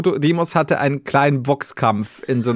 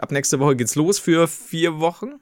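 usual speaking voice

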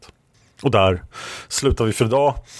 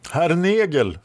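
high, ringing address; proclamation; 10 semitones higher, slower than usual. A narrator's reading. Red Lady addresses the enemy knight, high proclamation. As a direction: announce the name grandly, pitch raised. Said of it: "förkunnade Röda Damen".

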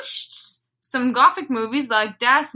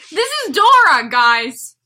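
put-on kids show host; 7 semitones higher, 7 dB louder than usual. Dora host voice.

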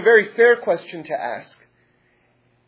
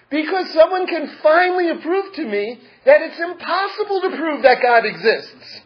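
higher, louder gleeful explaining; gleeful rabbi; 9 semitones higher, 4 dB louder than usual. Acted. Rabbi explains his joy to the student, gleeful. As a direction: bubble over with delight.

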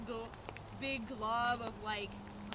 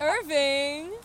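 mock scared cry; 4 semitones higher, 8 dB louder than usual. Mock scream at the monster.

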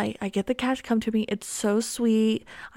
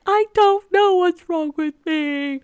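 higher and louder, crying it out; tearful wail; 8 semitones higher, 7 dB louder than usual. Retold or imitated. She answers her husband mid-breakdown, tearful and loud. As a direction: sob the words, helpless. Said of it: "burst into tears crying".

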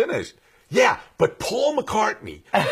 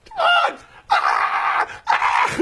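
shrill high panicked shriek; screaming secretary; 4 dB louder, much slower than usual. Retold or imitated. Secretary bursting in on the director, shrieking with excitement. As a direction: burst in shrieking his name.

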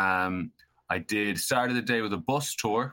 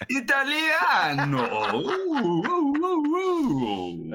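high, drawn-out operatic Italian whoop; exaggerated Italian cry sliding into oohs; mock Italian; 4 dB louder, much slower than usual. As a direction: stretch it high like a hammed-up Italian. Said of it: "where are you from?".

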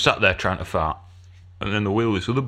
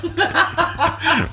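laugh. Real, not acted. Laughing at her own story.

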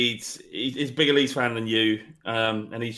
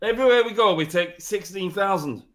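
put-on boastful Yorkshire fan accent, a bit higher; 4 semitones higher than usual. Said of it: "Give me a Yorkshire accent".

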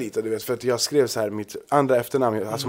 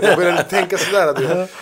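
laughing; 7 semitones higher, 5 dB louder than usual. Real, not acted. Laughing at own plan.

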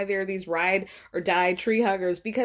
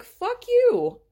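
higher, louder, slow outraged; indignant outburst; 11 semitones higher, 4 dB louder than usual. Real, not acted. Vlogger reacting to the show, slow indignant outburst. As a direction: spit it out, stretched and indignant.

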